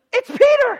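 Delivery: very high, loud gasp of disbelief; as if seeing a ghost at the door; astonished. Acted.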